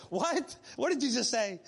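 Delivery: rising what, then a startled question; wife's surprised what. Retold or imitated. Wife caught off guard by the question, surprised. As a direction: echo her shocked disbelief. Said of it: "My wife is saying".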